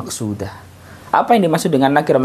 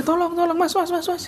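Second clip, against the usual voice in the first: very high, softer plaintive calls; mock blind man pleading, much higher and softer; blind man's cry; 8 dB quieter than usual. Shown as a demonstration. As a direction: beg anyone around, high and thin.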